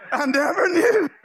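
high, exclaimed disbelief; mock amazement. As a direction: blurt it out high, playing up the shock. Repeats reply louder, mocking quiet home.

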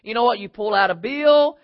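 ordinary delivery

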